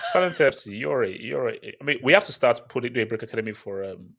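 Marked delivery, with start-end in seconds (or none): none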